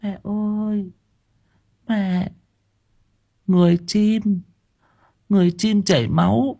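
put-on a scared child clinging to his mother; frightened whimpering child. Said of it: "mếu máo".

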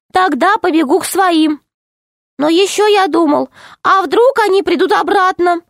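high little gnome, anxious; tiny gnome voice. Acted. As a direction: squeak it out like a small worried gnome. Gnome voice read aloud. (тоненький голос гнома)

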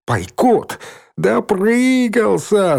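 high, drawn-out crowing; jeering cry without real laughter; gloating. A narrator's reading. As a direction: crow over the boy's downfall. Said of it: "захохотал Валька".

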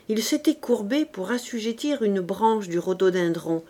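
usual speaking voice

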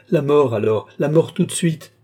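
loud cry climbing in pitch; desperate howl. A narrator's reading. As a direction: demand it at a shout, frantic. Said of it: "hurla Lorenza".

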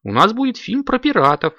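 high, insistent protest; indignant. A narrator's reading. Director corrects his actors about the film, high and indignant. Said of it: "возмутился он".

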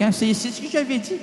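ordinary talk, nothing marked

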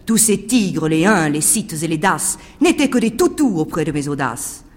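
plain reading voice

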